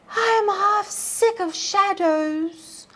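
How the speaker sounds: high, slow, weary voice of the lady. A narrator's reading. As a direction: sigh it out like a trapped lady, tired of shadows. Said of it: "said the Lady of Shalott".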